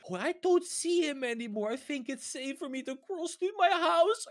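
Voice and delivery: normal talking voice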